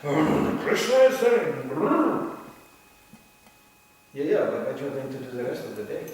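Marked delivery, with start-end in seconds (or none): 0.0-2.4 mock pompous lecturer. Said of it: "you can sit here and go".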